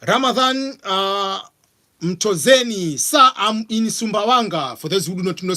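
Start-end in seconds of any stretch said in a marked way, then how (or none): none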